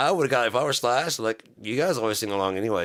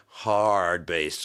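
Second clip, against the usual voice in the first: ordinary talk, nothing marked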